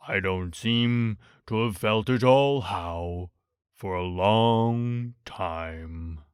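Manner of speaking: low, slow, mournful donkey voice; gloomy Eeyore. A narrator's reading. Narrator voicing Eeyore to Pooh, low and glum. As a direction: drag it out like a sad old donkey.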